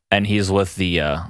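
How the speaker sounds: ordinary talk, nothing marked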